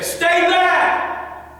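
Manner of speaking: high, loud shouted command; forceful warning. Shown as a demonstration. Coach models the warning to attacker, loud high shout. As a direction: yell it to drive an attacker off. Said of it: "Sometimes you're gonna say".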